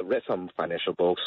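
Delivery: usual speaking voice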